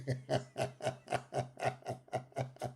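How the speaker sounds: long, real belly laugh; helpless laughter. Real, not acted. Streamer tickled by a viewer's joke, laughing helplessly. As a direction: crack up and keep laughing.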